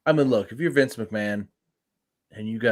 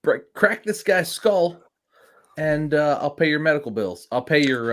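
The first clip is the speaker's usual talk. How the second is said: raised, lightly acted call to the troops; boss giving orders; 6 semitones higher, 4 dB louder than usual. Podcaster plays boss sending out locker room, mock order. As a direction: pitch it up like a mock rallying order. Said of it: "and you tell them".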